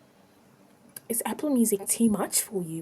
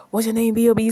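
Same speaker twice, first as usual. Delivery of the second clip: ordinary delivery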